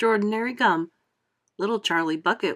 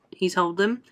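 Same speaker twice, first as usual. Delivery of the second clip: ordinary delivery, nothing marked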